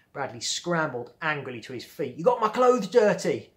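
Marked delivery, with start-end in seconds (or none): 2.2-3.5 higher, louder hammed-up wail; angry yell. Bradley on the ground, yelling at the girls. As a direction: shriek at the girl in outrage. Bradley after falling, furious.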